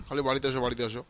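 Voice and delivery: ordinary delivery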